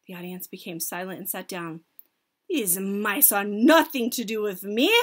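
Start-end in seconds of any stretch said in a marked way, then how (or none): none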